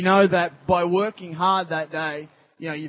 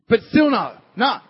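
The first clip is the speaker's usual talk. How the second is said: firm refusal; 5 semitones higher, 8 dB louder than usual. Acted. Acting Peter's refusal.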